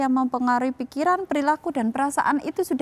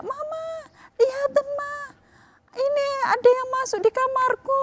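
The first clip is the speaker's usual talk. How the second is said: imitated child calling out; 11 semitones higher than usual. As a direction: call for mum in a much higher child's voice, without real volume. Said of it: "anak saya teriak-teriak".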